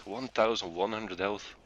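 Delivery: ordinary talk, nothing marked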